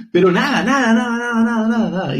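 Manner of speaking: insistent repeat. Real, not acted. Repeating nada with rising insistence.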